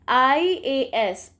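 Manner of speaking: आईएएस given letter by letter, very slowly; spelled out slowly. Shown as a demonstration. Teacher sounding out आईएएस for students, slow. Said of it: "इसमें sound किसकी आ रही है".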